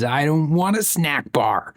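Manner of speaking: put-on high, slow, sulky little-boy voice; sulky child. Father imitating his hungry son refusing a snack, high and sulky. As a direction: pout it out like a grumpy toddler. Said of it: "and he says".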